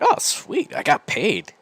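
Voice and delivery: retold thrill at a windfall; delighted. Retold delight at being paid.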